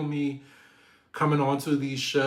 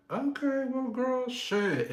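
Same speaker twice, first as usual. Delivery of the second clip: sassy Giselle; 12 semitones higher, slower than usual. Retold or imitated. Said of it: "And Giselle said".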